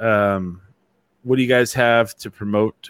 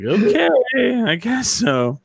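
high drawn-out okay, then grudging; mock-reluctant going along with it; dubious acceptance; 11 semitones higher, much slower than usual. Host reacts to old actors playing freshmen, high-pitched dubious acceptance. Acting out doubtful reaction to casting.

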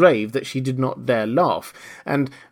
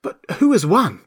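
high, raised, insistent question; eager asking; 8 semitones higher, 5 dB louder than usual. A narrator's reading. Runners crowding round the dodo, eagerly asking. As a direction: ask it loudly and eagerly. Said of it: "panting and asking".

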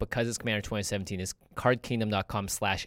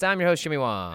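ordinary delivery

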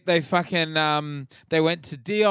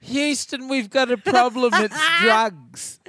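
mock dramatic announcement; 8 semitones higher, slower than usual. Repeats the Houston joke.

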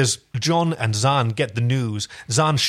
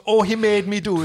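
higher-pitched mock Irish-Jamaican accent; Dublin-Kingston accent; 7 semitones higher than usual. Host mimicking the poor woman in the show, Dublin-Kingston accent. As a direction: put on a muddled Dublin-meets-Kingston accent. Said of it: "poor woman from Dublin slash Kingston".

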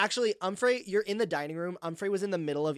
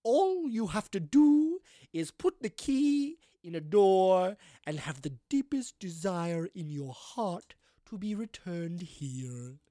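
ghostly madam; 4 semitones higher, 4 dB louder, slower than usual. Acted. Ghost explains the key's magic, slow and ceremonious. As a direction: intone the instructions like a grand medium. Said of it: "and she says".

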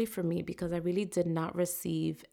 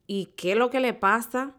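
accusatory; 5 semitones higher, 6 dB louder than usual. Retold or imitated. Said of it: "it sounds accusatory".

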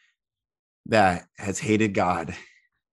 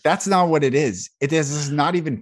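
urgent insistence; 5 semitones higher, 6 dB louder than usual. Real, not acted. Host cutting in on the guest, urgent and louder. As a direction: cut in, higher and louder. Cuts in to reject praise.

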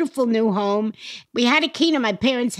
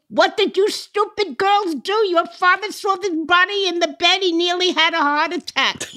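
high-pitched scolding, mildly yelled; imitated screaming mother; 5 semitones higher than usual. Mother scolding her daughters after a prank, imitated screaming. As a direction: tell off the daughters in a mock scream. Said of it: "my mother was screaming".

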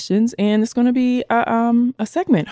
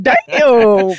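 loud, drawn-out mock amazement; retold astonishment; 5 dB louder than usual. Host retells seeing the long line behind, loudly amazed. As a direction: blurt it out, impressed and stretched. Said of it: "I'm like".

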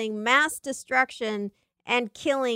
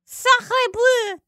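mock French; 13 semitones higher, 6 dB louder than usual.

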